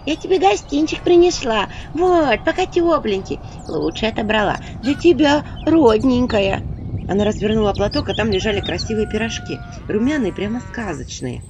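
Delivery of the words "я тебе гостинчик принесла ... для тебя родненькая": high, sugary coaxing; a fawning village woman; honeyed wheedling. Acted. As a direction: sweet-talk the witch with a gift. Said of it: "елейный голос".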